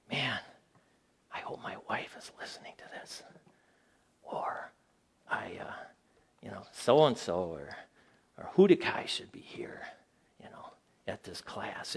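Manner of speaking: hushed whisper, like a private thought. Pretend. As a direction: murmur it so nobody else in class hears. Imagined listener's private thought.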